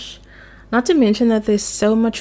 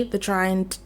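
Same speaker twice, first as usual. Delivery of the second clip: ordinary talk, nothing marked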